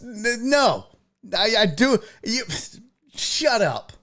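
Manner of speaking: mock bickering. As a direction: squabble like a child caught out. Mock argument with Amos.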